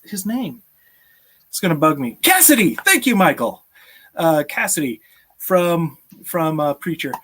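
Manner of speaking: ordinary delivery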